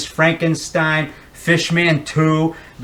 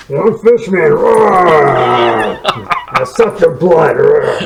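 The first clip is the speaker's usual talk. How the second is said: loud mock monster roars; hammed-up creature threatening to suck blood; Fishman roar; 5 dB louder, much slower than usual. In his Fishman claws, hammed-up monster roar.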